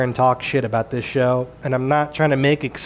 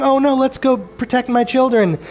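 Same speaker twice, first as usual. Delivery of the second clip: higher-pitched mock worry; mock alarm; 7 semitones higher than usual. Reviewer voicing what the witches should have said, mock alarm. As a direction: imitate a concerned parent, pitched up.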